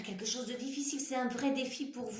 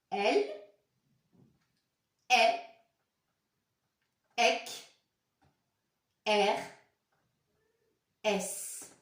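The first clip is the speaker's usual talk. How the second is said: each letter called out separately and louder; letter-by-letter reading; 2 semitones higher, 5 dB louder, slower than usual. Shown as a demonstration. Teacher leading children in reading letters one by one. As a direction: spell it aloud for the class to repeat. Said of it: "Ensemble, on va lire".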